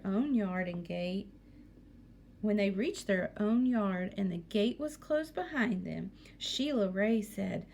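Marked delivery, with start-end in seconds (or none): none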